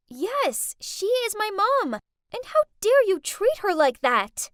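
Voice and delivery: loud, high defiant outburst; indignant. Acted. Daughter defending her mom to the rich kids, loud and indignant. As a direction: stand up for mom, fiercely.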